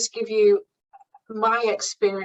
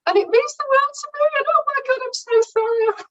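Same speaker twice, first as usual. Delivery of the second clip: high, louder tearful wail; mock crying; 5 dB louder than usual. Interviewee retelling how she broke down before the panel, high mock-crying wail. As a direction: imitate bursting helplessly into tears. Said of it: "And I couldn't stop crying".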